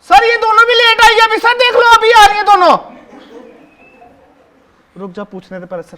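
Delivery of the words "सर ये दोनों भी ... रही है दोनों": much higher, louder snitching cry; loud tattling. Acted. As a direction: call out to sir to tell on the girls. Tattling on late girls to teacher.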